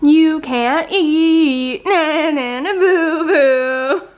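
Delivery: put-on slow, sing-song childish teasing; playful taunt. Teasing a numb-mouthed partner about the sandwich, mock-gloating. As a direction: draw it out like a playground taunt.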